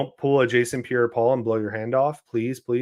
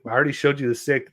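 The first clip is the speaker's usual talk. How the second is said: ordinary delivery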